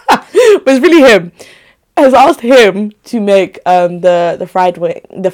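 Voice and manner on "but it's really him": said through a laugh, higher and louder. Real, not acted. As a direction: giggle it out, teasing him. Teasing about her partner's cooking.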